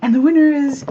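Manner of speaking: high, lightly voiced winner announcement; announcer quote. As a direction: read the winner like a host. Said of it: "And she says".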